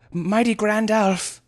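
high, breathless address; panting. Acted. Winded youth addressing the sorcerer, panting. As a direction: gasp out his title, drawn out. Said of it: "he eventually panted".

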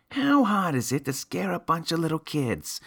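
put-on high, bratty kid voice; child voice. A narrator's reading. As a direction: pitch up into a whiny kid.

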